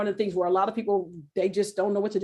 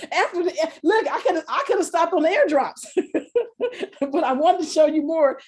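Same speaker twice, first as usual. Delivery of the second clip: laughing; 7 semitones higher than usual. Real, not acted. Laughs at her own sales pitch.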